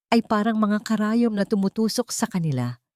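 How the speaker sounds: ordinary delivery, nothing marked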